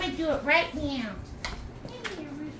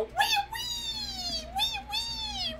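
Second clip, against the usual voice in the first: very high, soft squealed wails; toy animals crying; 8 dB quieter, much slower than usual. Pretend. Voicing crying lion and unicorn toys, squeaky soft wail. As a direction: squeal repeated fake cries. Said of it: "Lion and Unicorn go".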